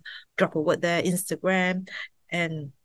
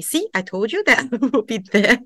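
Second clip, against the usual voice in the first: laughing; 7 semitones higher, 7 dB louder than usual. Retold or imitated. Voicing God, breaking into laughter.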